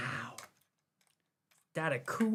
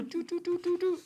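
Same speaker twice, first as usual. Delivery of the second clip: playful humming sound; 13 semitones higher than usual. Pretend. Imitating mouse sounds.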